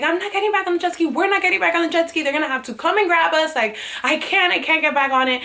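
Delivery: high, fast tearful pleading; panicked refusal through sobs, rushing; retold hysterical crying. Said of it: "crying hysterically".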